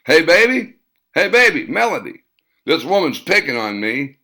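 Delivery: loud, high call across the store; shouting. A narrator's reading. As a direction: holler for help like a desperate man. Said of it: "raised one arm above his head and shouted".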